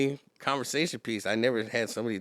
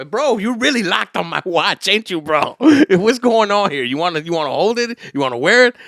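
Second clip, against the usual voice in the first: louder, higher, half-laughing taunt; loud amused challenge; 7 semitones higher, 13 dB louder than usual. Retold or imitated. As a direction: laugh him down over the watch. Retold watch confrontation, loud.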